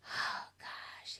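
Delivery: sighed out under the breath, very soft; exasperated whisper. Real, not acted. Player fed up with a wordy game character, exasperated whisper. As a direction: breathe it out wearily, almost voiceless.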